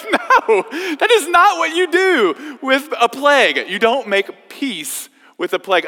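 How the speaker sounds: high, loud, laughing protest; laughing retort. Retold or imitated. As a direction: cry out in amused disbelief. Roommates' laughing retort to Peter.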